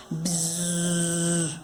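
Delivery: long, steady, soft buzzing; beetle buzz. Acted. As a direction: imitate a beetle's drone, held on one note. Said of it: "começou a zumbir". (zumbido de besouro, longo e baixinho)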